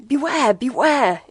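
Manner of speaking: high, loud, harsh and strained warning; screamed warning. A narrator's reading. Strange radio voice warning the listeners, screamed. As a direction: scream it like a desperate alarm. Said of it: "it screamed".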